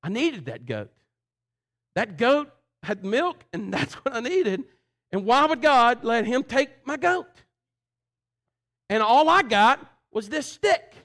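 high, loud and slow, voice straining with dismay; discouraged lament. Retold or imitated. Livingstone to the leader, anguished and discouraged. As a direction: cry out the loss of the goat in despair. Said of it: "but in a discouraging way".

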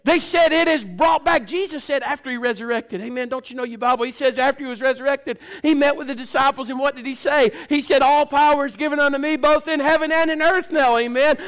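forceful, rhythmic pulpit preaching; preaching at full force. Real, not acted. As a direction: drive each question home to the congregation.

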